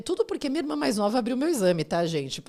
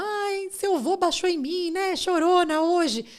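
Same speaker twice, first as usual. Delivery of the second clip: mock weepy mother; 10 semitones higher, 5 dB louder, slower than usual.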